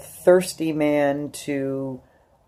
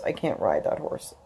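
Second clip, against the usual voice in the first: crying, lower and softer, words breaking; sobbing whisper; 8 semitones lower, 6 dB quieter than usual. Real, not acted. Vlogger crying to viewers about her lost riding. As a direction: voice breaking into a sob. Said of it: "here I am bawling again".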